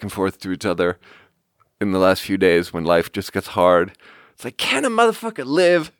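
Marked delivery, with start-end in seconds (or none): 4.6-5.9 high-pitched mock exasperation; Larry David. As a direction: throw it out as a high, comic plea. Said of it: "looks into the camera and he goes".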